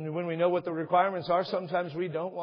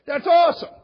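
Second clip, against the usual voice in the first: big high burst of delight; thrilled, beaming shout; excited awesome; 12 semitones higher, 11 dB louder than usual. Shown as a demonstration. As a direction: really sell it, high and loud. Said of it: "And that's how I say it".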